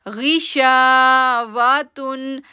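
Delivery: गिशावतुन chanted very slowly with शा held two seconds; slow tajweed chant; drawn-out recitation. Shown as a demonstration. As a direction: stretch the शा in गिशावतुन. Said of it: "शा को खींचेंगे".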